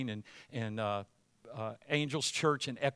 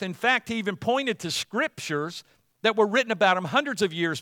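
louder sermon voice, rising in force; preaching; 3 semitones higher, 6 dB louder than usual. Acted. Pastor recounts Jesus' claims to the church, preached loudly. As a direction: preach the story with growing fire.

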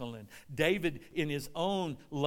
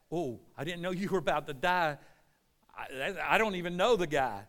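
louder, quicker disavowal; Peter denying; 6 dB louder than usual. Acted. Peter disowning Jesus, in loud denial. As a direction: protest that you have no idea who he is. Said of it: "remember Peter denying".

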